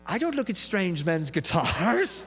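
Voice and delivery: prim rebuff. Retold or imitated. Woman rebuffs him at bus stop.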